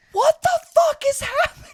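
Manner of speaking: high, loud, baffled yell; exasperated outburst. Retold or imitated. Passenger who cannot conceptualize the situation, exasperated shout. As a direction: explode upward in comic bewilderment.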